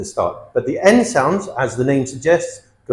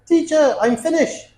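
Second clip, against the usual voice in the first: high-pitched student calling "finished" without its final t; student's mistake; 12 semitones higher than usual. Retold or imitated. Student tells teacher they are done, high voice, "finished" as "finish". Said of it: "No, you are finished".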